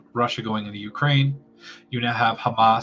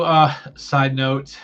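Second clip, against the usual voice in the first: usual speaking voice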